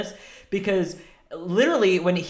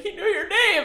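high, laughing imitation of mom; mock teary mom voice, much higher; mom through tears. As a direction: squeak it out like a tearful mom. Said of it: "She's like".

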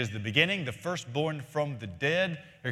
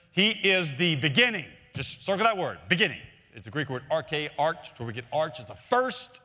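higher, louder, driving pulpit cadence; fervent preaching; 5 semitones higher, 4 dB louder than usual. Acted. Pastor to congregation about true churches, preaching at full force. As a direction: press every phrase home like a revivalist.

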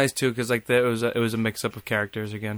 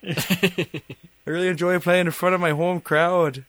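mock Canadian hockey player; 5 semitones higher than usual. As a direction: ham up a Canadian player pretending to be a New Yorker. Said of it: "They're all like".

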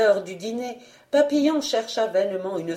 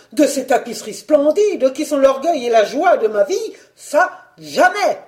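outraged protest; 3 semitones higher, 6 dB louder than usual. Acted. Collector defends his treasures to his wife, loud mounting outrage.

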